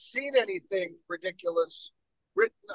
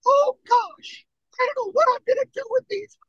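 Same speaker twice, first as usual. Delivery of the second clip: very high, squeaky cartoon imitation; Mickey Mouse. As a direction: squeak it out in a fast mock falsetto. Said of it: "You sound like Mickey Mouse".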